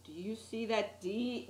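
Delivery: usual speaking voice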